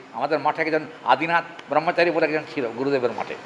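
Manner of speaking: normal talking voice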